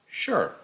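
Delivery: "Sure" starts high and drops sharply; falling intonation. Shown as a demonstration. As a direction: say "Sure" with a decisive downward glide. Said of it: "started with a falling intonation".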